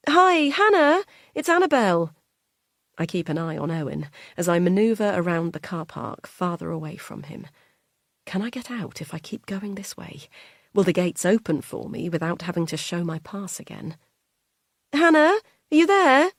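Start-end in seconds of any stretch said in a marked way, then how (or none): 0.1-2.1 much higher, louder sing-song carer voice; chirpy carer calling on the phone; high bright phone voice of a carer; Annabelle the carer. Acted. 8.3-10.3 whispered thought. Acted. Anxious inner thought while fleeing. 14.9-16.3 much higher, louder sing-song carer voice; chirpy carer calling on the phone; high bright phone voice of a carer; Annabelle the carer. Acted.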